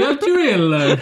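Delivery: high, laughing mock-Italian accent; hammed-up Italian through laughter; ridiculous Italian accent. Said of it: "with a ridiculous Italian accent".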